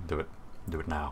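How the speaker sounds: low, soft prod; quietly egging someone on; quiet prompt. Acted. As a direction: say it softly and low, under your breath. Prodding friend to switch accent.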